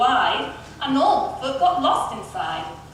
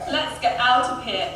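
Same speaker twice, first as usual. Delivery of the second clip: usual speaking voice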